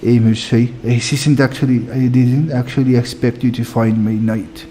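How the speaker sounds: put-on French-accented game noble reading dialogue. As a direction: play a formal character with a French accent. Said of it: "These people are super French".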